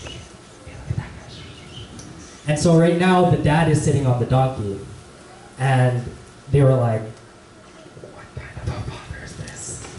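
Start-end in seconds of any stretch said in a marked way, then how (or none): 0.0-1.3 whispered, disapproving aside; gossiping whisper. Acted. Group of ladies gossiping about the dad, whispering. 8.1-9.8 hushed, whispered gossip; disapproving whisper. Acted. Ladies judging the father on the donkey, whispering.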